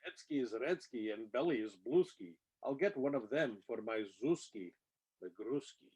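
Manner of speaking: usual speaking voice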